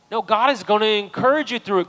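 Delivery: loud, high, fervent preaching to the church; full-force preaching. Real, not acted. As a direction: lift the room, preach it loud to the congregation.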